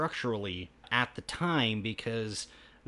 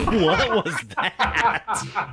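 slow, loud, laughing question; cracking up mid-question; 6 semitones higher, 4 dB louder, much slower than usual. Real, not acted. Laughing at the robot voice.

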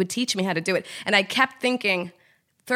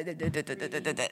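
low, much softer stammering syllables; mock stammering; 5 semitones lower, 12 dB quieter, faster than usual. Imitating herself stumbling over prompter copy, low and soft. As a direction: mumble the stumbling under your breath.

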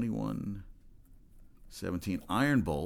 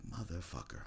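muttered curse; 3 semitones lower, 12 dB quieter than usual. Retold or imitated. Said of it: "I was like".

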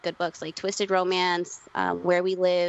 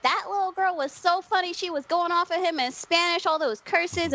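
much higher delighted mom voice; imitating mom; 10 semitones higher than usual. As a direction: gush like a thrilled mother. Mom raving about Logan.

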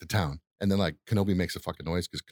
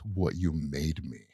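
Darth Vader; 4 semitones lower, 4 dB quieter, slower than usual. Retold or imitated. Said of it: "And Darth Vader says".

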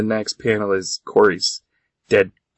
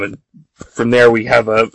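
normal talking voice

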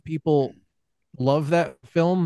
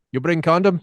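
mock Russian accent, raised in pitch; playfully imitated Russian accent, a little higher; 4 semitones higher, 3 dB louder than usual. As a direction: repeat it teasingly in a put-on Russian accent. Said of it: "while we're on the Russians".

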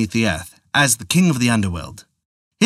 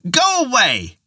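high-pitched frightened cry; old woman crying out; 12 semitones higher, slower than usual. A narrator's reading. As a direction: cry out high to drive the imp off. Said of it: "she cried out loudly".

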